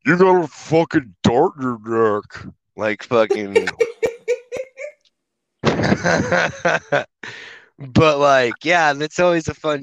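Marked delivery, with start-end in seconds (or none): 0.1-2.5 slowed-down drawn-out voice; Slow-Mo voice guy. Retold or imitated. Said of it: "whenever I do Slow-Mo voice guy". 2.8-7.1 laughing through the words. Real, not acted. Laughing at own joke.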